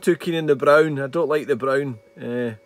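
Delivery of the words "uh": ordinary talk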